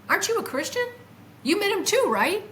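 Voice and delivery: can't believe he hasn't met him; incredulous. Retold or imitated. Asking Dean if he met Jesus too, incredulous and high.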